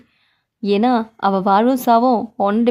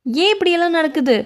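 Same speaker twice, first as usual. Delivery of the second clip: ordinary talk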